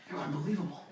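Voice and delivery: hushed and adoring; soft admiration. Retold or imitated. Wife-to-be softly praising the narrator, near whisper.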